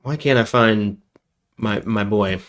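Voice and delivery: ordinary delivery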